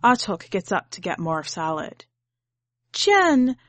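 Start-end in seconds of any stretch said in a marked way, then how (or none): 2.9-3.6 high, loud, insistent question; demanding. A narrator's reading. Grandmother demanding why police came, loud and high. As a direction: demand an answer, sharp and loud. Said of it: "she demands".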